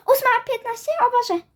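high, fast panic after a gasp; gasp, then blurt it out high; alarmed gasp. Acted. Child sees she may be late, high mock alarm. Realises time, fears being late.

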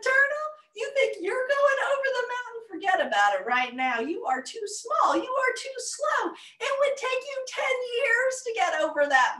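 high, fast scornful taunt; mocking. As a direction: laugh him down, you're too small. Animals mock the turtle.

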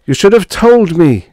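high, loud anguished moan; a husband's despair spilling out. A narrator's reading. Kneeling husband to his wife on the cot, moaning. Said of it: "moaned Martin".

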